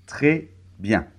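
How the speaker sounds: ordinary talk, nothing marked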